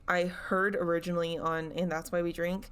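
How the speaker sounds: ordinary delivery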